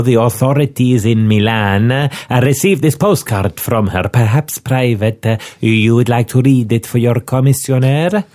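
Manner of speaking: put-on a pompous Milanese inspector in an Italian accent. Inspector introduces himself to Scotland Yard, Italian accent. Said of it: "to do the accent".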